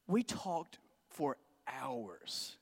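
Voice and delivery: very slow, low and soft; drawn-out, hushed statement; slow emphasis. Real, not acted. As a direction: let each word hang, hushed. Savoring the climax of the story.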